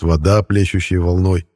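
usual speaking voice

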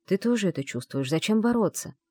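plain reading voice

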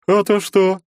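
high, loud challenge turning aggressive; sudden aggression. A narrator's reading. Stranger answering the hero's warning with aggression. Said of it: "сменив презрительно-насмешливый тон на агрессию".

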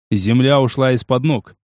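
ordinary delivery, nothing marked

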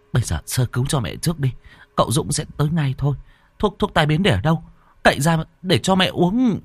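quick, high-pitched instructions; older sister, urgent. Acted. Sister orders first aid for mother, hurried urgency.

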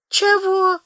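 high, sleepy squeak; a just-woken man croaks out; sleepy startle. Acted.

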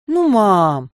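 put-on loud whiny teen protest; whiny little sibling. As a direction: whine it at mum, drawn out. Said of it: "заныла Юлька".

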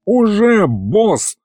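fighter answering mid-battle; broken, straining voice. A narrator's reading. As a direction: answer jerkily while fighting. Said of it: "голос его был прерывистым".

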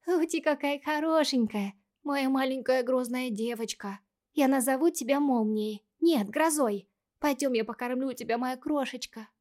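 put-on high cooing baby talk to a kitten. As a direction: coo it like to a tiny pet. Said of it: "сюсюкала Сиви". (сюсюканье)

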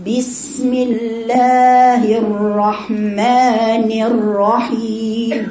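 very slow, held, chanted tajweed with "bi" in بسم; drawn-out melodic recitation on a steady pitch; correct basmala recitation. Shown as a demonstration. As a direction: chant بسم الله stretched out, every vowel exact. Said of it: "وليست باسم".

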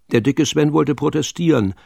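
ordinary delivery

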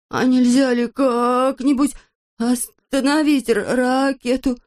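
slow, high wail catching on the first sound; frightened stammer. Acted. Frightened Пончик stammers a plea.

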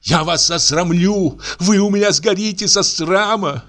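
threatening shout. A narrator's reading. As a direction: swear to shame them. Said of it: "кричала Аксинья". (гораздо выше, злая угроза)

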